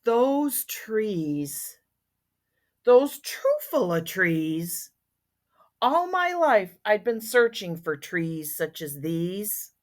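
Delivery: lingering, expressive storybook reading; story narration. A narrator's reading. Once-ler recalling the trees he found, expressive storybook narration. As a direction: read aloud, drawing out key words.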